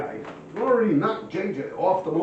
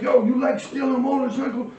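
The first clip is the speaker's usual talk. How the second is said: a hint of a threatening thug, higher; menacing gangster; 8 semitones higher, 4 dB louder than usual. Gangster confronting the bike thief, mock menace. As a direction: sneer the question at him.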